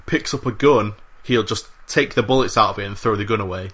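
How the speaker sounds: ordinary talk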